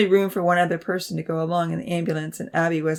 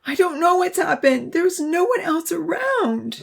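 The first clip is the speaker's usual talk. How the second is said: high, distraught wail; high voice, drawing out the last words; crying out; 12 semitones higher, 4 dB louder than usual. A narrator's reading. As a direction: plead helplessly in a high voice. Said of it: "someone was crying".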